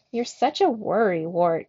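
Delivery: usual speaking voice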